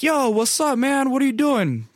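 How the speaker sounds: high, eager bro hello, acted out; high-pitched retold hello; excited greeting. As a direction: replay greeting a pal with enthusiasm.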